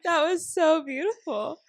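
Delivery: high, drawn-out tearful praise; moved to tears. Real, not acted. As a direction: gush slowly, overcome with real emotion. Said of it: "getting emotional".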